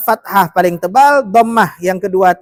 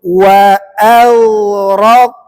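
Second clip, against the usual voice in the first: slow, drawn-out melodic tajwid chanting; Quran recitation; 3 dB louder, much slower than usual. Acted. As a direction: chant each phrase slowly for the class to repeat. Recitation for repeating.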